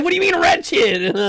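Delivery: high, loud indignant squeal; indignant outburst. Real, not acted. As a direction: shoot back, offended and loud. Indignant reply to chat.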